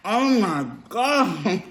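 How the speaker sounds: slow oh my God melting into a laugh; laughing awe. Real, not acted. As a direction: laugh with delight. Dipping in sauce, laughing.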